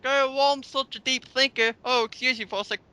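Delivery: high, snooty mock voice.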